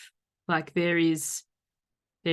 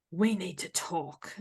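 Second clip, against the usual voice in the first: ominous we need to talk; 5 dB quieter, slower than usual. Shown as a demonstration. Showing how a text can read as a threat, quiet and heavy. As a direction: say it as a dreaded summons. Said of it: "the words are exactly the same".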